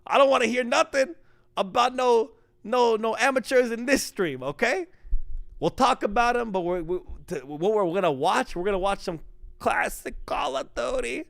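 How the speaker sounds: high, hammed-up New York-ish accent; put-on accent voice. Streamer slipping into his accent voice for chat, hammed-up. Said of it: "Oh, you're doing it again".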